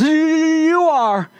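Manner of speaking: high, nervous stutter; stammering animal. Acted. Frightened animal answering the lion: high, stammering voice. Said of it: "And the animal says".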